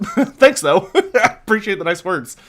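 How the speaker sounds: giggly, bashful gratitude; laughing thanks. Real, not acted. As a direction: laugh it off while thanking chat. Reacting to chat's kind words.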